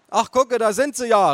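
calling mother. A narrator's reading. Mother spotting family on arrival, calling out. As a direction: call out on spotting them. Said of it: "rief meine Mutter".

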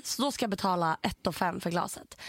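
usual speaking voice

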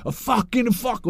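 high, slow furious curse; imitating Ferguson swearing. Player imitating Ferguson raging at referees in the tunnel, angry. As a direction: spit out the curse like an enraged manager.

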